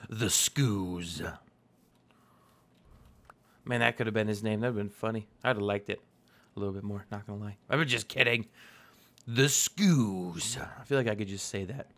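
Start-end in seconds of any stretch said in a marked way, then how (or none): 0.1-1.2 lightly voiced announcer; low dramatic announcer read. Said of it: "My announcer voice". 9.3-10.6 a hint of an announcer. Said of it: "My announcer voice".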